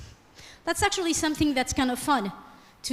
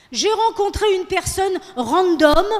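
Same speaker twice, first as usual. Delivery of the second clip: trendy speaker imitation; 5 semitones higher, 6 dB louder than usual. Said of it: "the same thing".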